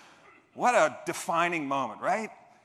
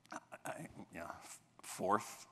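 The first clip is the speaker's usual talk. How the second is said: sheepish mumble; 6 semitones lower, 12 dB quieter than usual. Retold or imitated. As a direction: barely get the word fourth out. Embarrassed admitting fourth place.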